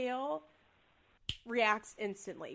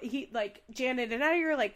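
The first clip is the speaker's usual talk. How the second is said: ordinary talk, nothing marked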